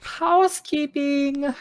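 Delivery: high singsong housekeeping call; mock housekeeping call. Guest outside the bedroom door, mock housekeeper's singsong. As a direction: sing it out high, drawn-out and loud, like housekeeping. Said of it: "housekeeping that sounds like that".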